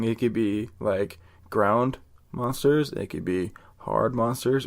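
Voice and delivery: ordinary talk, nothing marked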